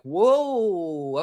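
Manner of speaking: a stretched, put-on whoa; mock reaction. Mocking the NFL milking the offseason, mock whoa. Mimics the league stretching out May.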